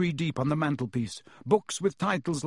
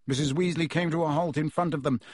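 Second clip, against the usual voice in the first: ordinary delivery, nothing marked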